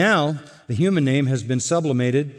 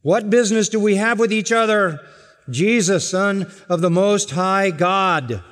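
shrieking demon voice; 7 semitones higher, 3 dB louder than usual. Acted. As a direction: scream it as the possessing demon. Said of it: "he screams with this shrieking, loud, demonic voice".

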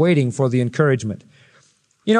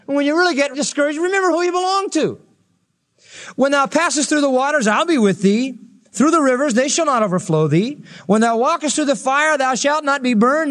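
preaching at full force; 8 semitones higher than usual. Acted. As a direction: lift it high and proclaim.

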